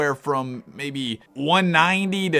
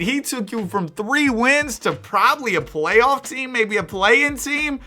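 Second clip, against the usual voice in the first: excited; 9 semitones higher, 3 dB louder than usual. Real, not acted. Teasing Shaq over the turnaround.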